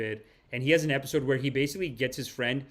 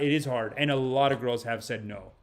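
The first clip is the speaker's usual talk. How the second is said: ordinary talk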